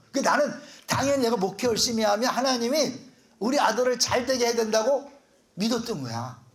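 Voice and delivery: normal talking voice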